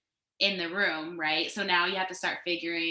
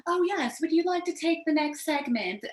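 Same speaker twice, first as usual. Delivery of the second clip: put-on high, prim British accent, like a polite host; mock-posh British host handing over the mic; exaggerated British accent, much higher than her own; 9 semitones higher, 4 dB louder than usual. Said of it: "I don't know why I got a British accent".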